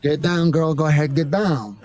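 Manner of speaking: lazy, cool Brando strut in the voice; hint of Brando at a party; Brando impression. Shown as a demonstration. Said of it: "a bit of a Brando kind of thing".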